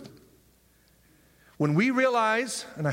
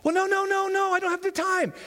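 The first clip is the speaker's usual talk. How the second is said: high protest; 13 semitones higher than usual. Acted. Voicing believer's excuse.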